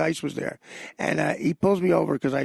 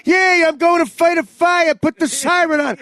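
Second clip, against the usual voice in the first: much higher, louder exasperated yelling; Dice yelling; 11 semitones higher, 7 dB louder than usual. Retold or imitated. Dice interrupted by fire trucks, yelling exasperated at them. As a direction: bellow at the passing fire trucks. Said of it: "he turns into Dice for a second".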